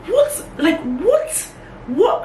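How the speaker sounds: high, incredulous what, cracking with outrage; outraged disbelief. Retold or imitated. Retelling the crash to viewers, shrieking in disbelief.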